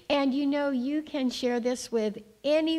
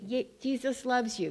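ordinary delivery

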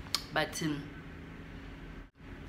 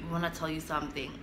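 hushed, softer confession; low voice; 3 semitones lower, 10 dB quieter, faster than usual. Retold or imitated. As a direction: say it low, as if scared to tell. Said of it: "their voices are so low".

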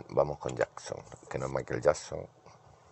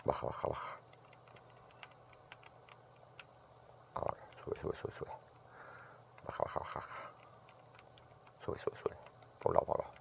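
whisper; 14 dB quieter than usual. Real, not acted. Whispering directions to himself.

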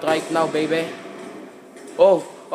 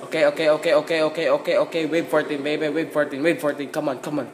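ordinary talk, nothing marked

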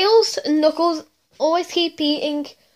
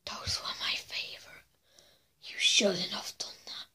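very soft, creepy whisper; hushed, ominous villain whisper; menacing whisper; 8 semitones lower, 14 dB quieter than usual. Acted. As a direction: whisper it as a quiet threat. Acted menace after losing favourite sweets.

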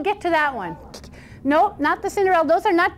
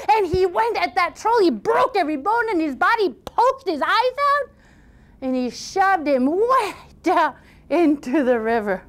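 high, punchy fight telling; storyteller at the climax; excited story narration; 4 semitones higher than usual. A narrator's reading. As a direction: build the fight with dramatic stresses. Tells the fight.

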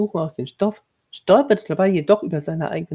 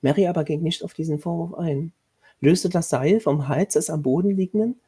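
ordinary delivery, nothing marked

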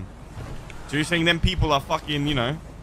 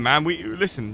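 ordinary talk

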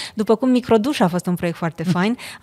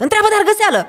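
sudden high yell of a producer; retold shout in earpiece; 13 semitones higher, 5 dB louder, faster than usual. Producer yelling through the earpiece, loud high shout. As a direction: bark the name into her ear, loud and high. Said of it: "în casca mea se aude dintr-o dată".

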